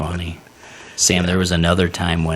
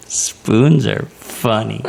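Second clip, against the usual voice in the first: very slow spoons are funny; slow dopey quote; 4 semitones higher, 3 dB louder, much slower than usual. Retold or imitated. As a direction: stretch it out, drugged and giggly. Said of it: "She goes".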